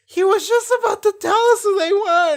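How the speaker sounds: high, whiny mock protest; hammed-up complaining teammates; mock whine. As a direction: squeal it like the exasperated team. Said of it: "everyone's like".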